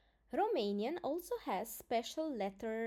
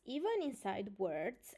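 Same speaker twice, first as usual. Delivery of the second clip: normal talking voice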